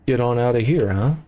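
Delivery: ordinary delivery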